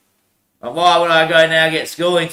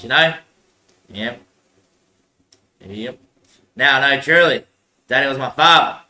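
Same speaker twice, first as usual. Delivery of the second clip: ordinary delivery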